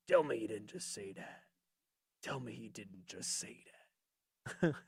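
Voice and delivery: deep, slow, soft wrestler drawl; low and slow, disbelieving; Booker T impression. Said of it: "Let me do my best impression".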